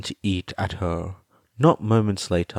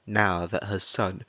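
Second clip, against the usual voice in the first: usual speaking voice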